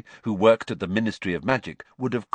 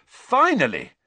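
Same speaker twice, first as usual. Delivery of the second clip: bright, much higher relief; grinning; 12 semitones higher, 5 dB louder than usual. A narrator's reading. Ron greets Harry at last, grinning and higher. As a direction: glad to finally find him. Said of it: "grinning at Harry".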